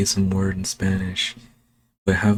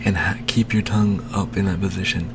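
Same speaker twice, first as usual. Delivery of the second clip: whisper. Real, not acted. Tongue trill breath practice.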